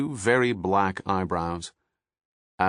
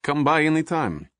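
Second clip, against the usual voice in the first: put-on inviting warmly in a Russian accent, pitched up; 7 semitones higher than usual. A narrator's reading. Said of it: "He spoke with a Russian accent".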